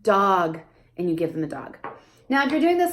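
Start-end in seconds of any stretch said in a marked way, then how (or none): 0.0-0.6 put-on labeling for child. Shown as a demonstration. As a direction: give the answer clearly. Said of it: "You say".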